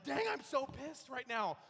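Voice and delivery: slow, high, loud seething anger; pissed off. Retold or imitated. Pastor voicing how angry he felt inside, slow and loud. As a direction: spit it out slowly, fuming. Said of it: "You're like".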